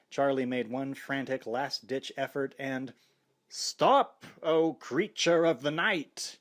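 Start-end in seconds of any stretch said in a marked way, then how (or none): 3.5-6.4 booming, slow vampire-hunter command; deep commanding. A narrator's reading. Film hero halting the vampire, loud and commanding. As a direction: thunder it like a stage hero. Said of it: "The voice was deep and commanding".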